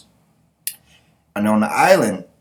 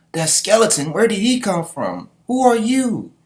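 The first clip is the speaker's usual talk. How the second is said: high-pitched, alarmed questioning; startled mermaid girl; 11 semitones higher, 3 dB louder than usual. Acted. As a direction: voice a startled girl, climbing higher on each question. Mermaid girl startled by Skull Knight.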